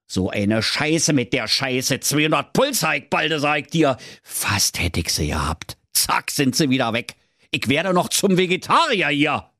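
mild put-on wolf grumbling. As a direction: huff it out like a wolf who missed his lunch. Wolf grumbles after losing prey.

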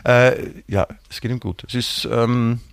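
ordinary delivery

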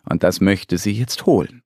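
ordinary delivery

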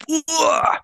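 high shuddering "uah" of disgust; disgusted groan. Real, not acted. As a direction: shudder out a grossed-out cry. Disgust at dirty restraints.

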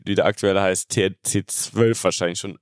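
ordinary talk, nothing marked

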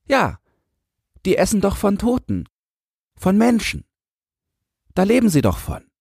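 put-on very high child's voice; earnest little boy. A narrator's reading. Boy explains the rats to the man, earnest.

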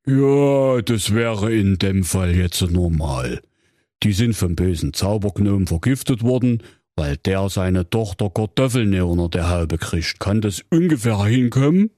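Grimm brother as a call-centre recording; a polite hotline greeting from Jakob Grimm. Acted. Grimm reads manual. (Jakob Grimm als Hotline-Ansage)

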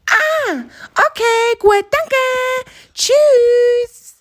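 high, drawn-out little hare voice. Acted. Hare thanks its friend and says goodbye, high-voiced. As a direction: chirp a long goodbye up high.

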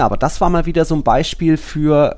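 ordinary delivery

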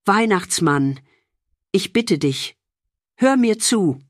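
calling out firmly, rising. A narrator's reading. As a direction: plead in a firm, steady voice. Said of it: "rief mit fester Stimme".

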